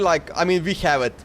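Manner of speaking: ordinary delivery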